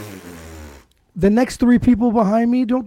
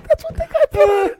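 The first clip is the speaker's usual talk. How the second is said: much higher, laughing through the quote; laughing mom quote. Retold or imitated. Retelling the mom's excuse to his friend, laughing hard and high. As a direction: repeat her excuse while cracking up.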